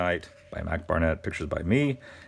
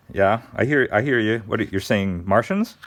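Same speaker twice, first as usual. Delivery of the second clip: ordinary delivery, nothing marked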